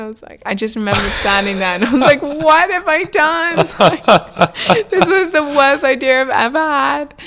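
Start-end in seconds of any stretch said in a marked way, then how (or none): 2.2-3.7 high, loud, slow dismayed wail; mock panic. Recalling the chef quitting, a loud slow panicked wail. 3.8-7.1 laughing through it, higher and louder. Real, not acted. Laughing while telling the interviewer about the worst idea.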